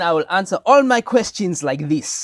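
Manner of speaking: put-on South African accent. Candidate jokingly answers the interviewer in a South African accent. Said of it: "I worked on my South African accent".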